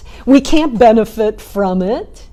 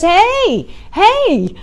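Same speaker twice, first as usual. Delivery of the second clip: polite call; 8 semitones higher than usual. Retold or imitated. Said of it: "he calls out nicely, politely".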